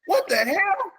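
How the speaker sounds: high-pitched mock bewilderment; imitating shocked, exasperated defenders; stunned disbelief. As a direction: squeal it like a baffled opponent. Acts out opponents' stunned reaction.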